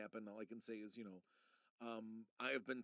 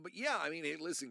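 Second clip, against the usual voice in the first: ordinary talk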